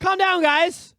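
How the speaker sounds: high, loud mock plea to calm down; mock placating. Mock plea to the witch-hunters.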